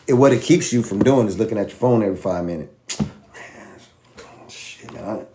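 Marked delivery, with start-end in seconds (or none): 4.5-5.3 soft muttered curse to himself; hushed mutter, barely voiced; under-breath mutter. Worried trader watching his phone, muttering under breath.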